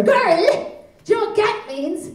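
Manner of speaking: put-on high Cork accent scolding; Cork girl. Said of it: "This girl said to her".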